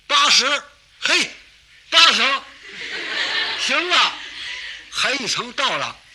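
loud high call of the floor number; excited shout. Acted. Climber shouting the floor number to his friends, loud and high. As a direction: shout out the floor you just reached.

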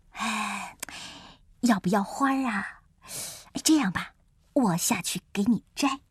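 low-pitched, gently offering tiger; deep tiger trying to make up; conciliatory tiger. Acted. Tiger offers to fetch flower.

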